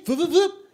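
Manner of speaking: high quick vips of the belt; high vip sound effect. Retold or imitated. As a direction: squeak out the treadmill noise. Treadmill sound effect repeated.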